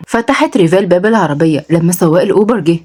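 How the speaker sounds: ordinary delivery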